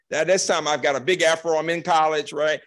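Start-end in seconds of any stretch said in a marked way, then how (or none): none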